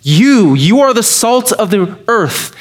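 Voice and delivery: emphatic You, you are the salt. Shown as a demonstration. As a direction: hit You hard, then drive are home. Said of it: "And this is emphatic".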